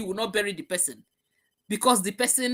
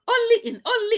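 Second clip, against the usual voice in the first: high exclaim; 5 dB louder than usual. Real, not acted. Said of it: "outburst on Africa".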